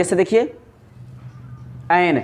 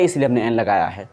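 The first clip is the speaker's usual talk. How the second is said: ordinary delivery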